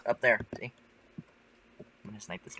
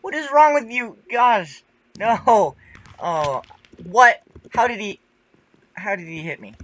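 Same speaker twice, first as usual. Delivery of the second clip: high, loud indignant yelling; outraged shriek; 10 semitones higher, 12 dB louder than usual. Real, not acted. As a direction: screech it out, furious at losing. Just killed, outraged.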